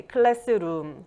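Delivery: slow Korean-accented "classroom", last syllable emphasized; Konglish accent. Shown as a demonstration. Teacher giving another pronunciation example, Konglish accent. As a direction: say "classroom" Korean style, extra vowels between consonants, slowly. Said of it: "it sounds a bit like Korean".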